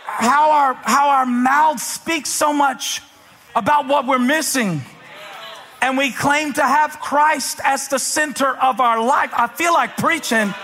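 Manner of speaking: high, ringing pulpit delivery; full-force preaching. Real, not acted. Preacher challenging believers with scripture, full-force preaching. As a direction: proclaim it to the whole congregation.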